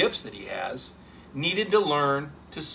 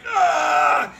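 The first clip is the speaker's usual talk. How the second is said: loud higher strained grunt under the bar; straining yell; 9 semitones higher, 6 dB louder than usual. Retold or imitated. Lifter on the bench straining against the weight, mock shout. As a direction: push out a lifting yell. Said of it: "you're like".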